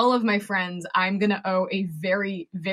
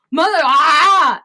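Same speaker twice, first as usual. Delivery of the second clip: drawn-out loud yelp, pitched up; mock outcry; 6 semitones higher, 9 dB louder, slower than usual. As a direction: cry out as if stung by the truth. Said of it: "where you're just like".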